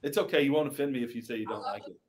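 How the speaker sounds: usual speaking voice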